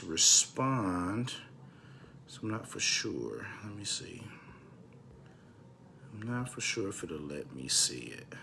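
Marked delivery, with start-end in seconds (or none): 2.3-8.3 low, soft murmur, nearly whispered; muttering to himself. Real, not acted. Host checking whether live comments show, muttering to himself. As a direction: drop to an under-the-breath mumble.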